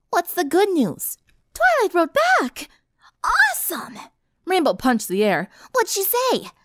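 put-on high, excited girl voice; eager tomboy. A narrator's reading. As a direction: jump on the news, eager. Rainbow voiced.

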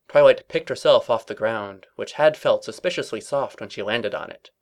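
usual speaking voice

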